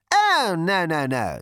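high, light dismissive oh; breezy captain. A narrator's reading. Captain brushing off number two, lightly voiced. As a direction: wave it off cheerfully. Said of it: "said the captain".

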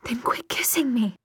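breathless, gasped whisper; gasping. Acted. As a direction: gasp the words out. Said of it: "she gasped".

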